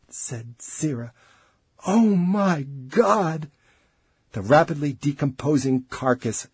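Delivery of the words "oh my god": very slow, high, stunned moan; horrified dismay. A narrator's reading. Colleague watching the corpse decay, horrified. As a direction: draw the words out in horror.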